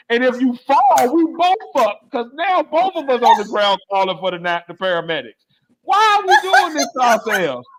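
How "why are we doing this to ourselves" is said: laughing rant. Real, not acted.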